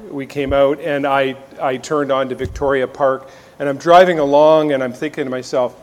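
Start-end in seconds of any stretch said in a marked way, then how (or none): none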